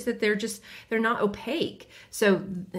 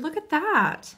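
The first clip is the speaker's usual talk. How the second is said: surprised; 7 semitones higher, slower than usual. Real, not acted. Surprised at color change.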